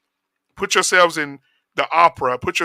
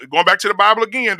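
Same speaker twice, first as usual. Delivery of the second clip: usual speaking voice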